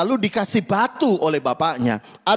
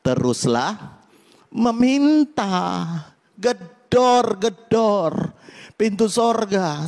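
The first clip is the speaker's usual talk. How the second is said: slow voice of God; 2 semitones higher, much slower than usual. Retold or imitated. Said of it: "Tuhan berkata".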